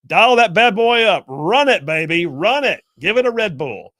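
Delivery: loud, high, stretched-out cheering on; mock hype. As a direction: egg the machine on at full volume. Mock egging on the reading machine.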